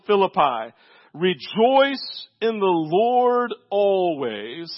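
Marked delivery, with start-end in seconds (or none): 1.1-4.7 "Rejoice in the Lord always" stretched out very slowly; slow, drawn-out proclamation of "Rejoice in the Lord always"; slow proclaiming. Shown as a demonstration. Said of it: "Everybody listen to me".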